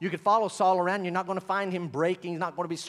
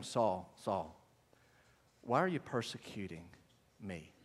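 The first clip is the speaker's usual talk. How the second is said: much lower and softer; softly; 11 semitones lower, 10 dB quieter than usual. Acted. Preacher rereading the heavenly question, hushed. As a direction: say it gently, almost to yourself.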